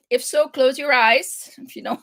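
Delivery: ordinary delivery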